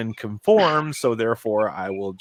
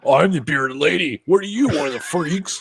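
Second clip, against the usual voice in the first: mocking voice; 5 semitones higher than usual. Mocking the bearded lady.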